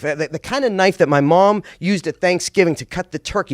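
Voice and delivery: ordinary talk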